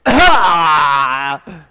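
loud hammed-up crying shout; mock wailing sob. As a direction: sob like a reluctant boy. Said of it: "Can you picture that?".

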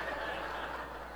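airy, silent laugh; breathless laugh. Real, not acted. Speaker laughs recalling the wig prank on mom. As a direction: let a breathy laugh escape.

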